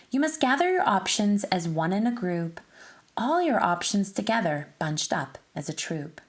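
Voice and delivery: usual speaking voice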